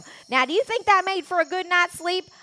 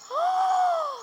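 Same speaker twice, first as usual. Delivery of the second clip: gasp; 13 semitones higher than usual. Acted. Sudden gasp before remark.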